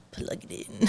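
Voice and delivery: breathy mock whisper of the kids; whispered quote. Friend echoing the kids, whispered hint. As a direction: whisper it like a sneaky kid. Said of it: "if you didn't hear".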